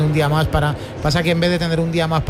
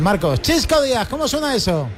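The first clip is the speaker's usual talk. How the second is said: calling to reporter; 8 semitones higher than usual. Real, not acted. Host calls out to the reporter.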